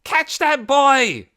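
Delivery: slow, high, loud command; angry shout. A narrator's reading. Ben shouting at the crowd to catch Dune. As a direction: order them to grab him. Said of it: "He heard Ben's voice shout".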